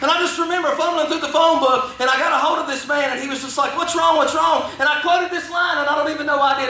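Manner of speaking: high, driving sermon cadence; full-force preaching. Real, not acted. Preacher testifying how Jesus pursued him, full-force preaching. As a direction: preach it out with rising fervor, pitched high.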